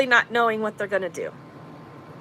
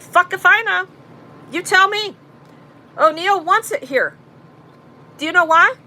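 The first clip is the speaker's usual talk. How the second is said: angry retort; 7 semitones higher than usual. Retold or imitated. Retorting angrily to the cop.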